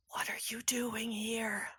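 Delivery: whispered, breathy question; hushed whisper. Acted. Tall woman whispering to the newcomers, hushed. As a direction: whisper it so only they hear.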